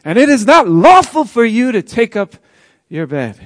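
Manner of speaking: a stern religious leader scolds the healed man for carrying his mat; stern religious authority. Acted. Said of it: "religious and stern and authoritative voice".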